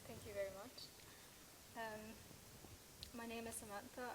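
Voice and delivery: distant and barely audible, as if the mic is off; faint off-mic. Real, not acted. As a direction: say your name far from the microphone. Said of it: "they're not hearing you".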